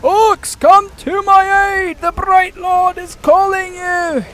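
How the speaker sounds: high, loud, slow rallying cry; commanding lord. Retold or imitated. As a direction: summon your orc army with grand authority. Commanding orcs as the Bright Lord.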